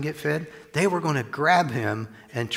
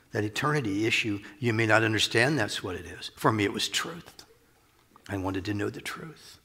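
ordinary talk, nothing marked

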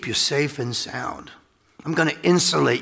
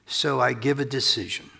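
ordinary talk

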